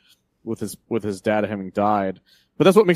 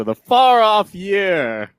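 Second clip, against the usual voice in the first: high, loud, drawn-out proclamation; grand future announcement; 9 semitones higher, 8 dB louder, much slower than usual. Pretend. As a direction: proclaim it slowly like an epic announcer. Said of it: "I like to say that".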